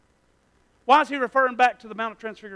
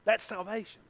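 normal talking voice

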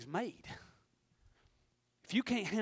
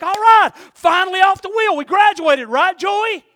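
much higher and louder, a giddy celebration; excited cheer; 11 semitones higher, 20 dB louder than usual. Acted. Pot cheering to a friend after leaving the wheel, giddy shout. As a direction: shout it like you just graduated.

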